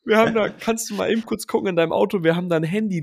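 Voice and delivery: butter up the driver; sugary friendly. Retold or imitated. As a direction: fake-friendly call to get the phone back. Suddenly very friendly phone call.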